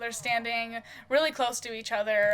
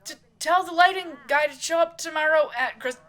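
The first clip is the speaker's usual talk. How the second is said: higher, louder startled stammer; flustered; 7 semitones higher, 8 dB louder than usual. Acted. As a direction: answer her rattled, caught off guard. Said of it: "his eyes widening a little bit".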